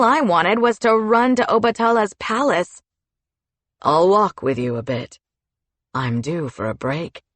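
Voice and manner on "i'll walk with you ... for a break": low, relaxed gatekeeper voice; lightly lowered voice of a friendly old acquaintance; Gavette voiced lower. A narrator's reading. Gavette's voice in story.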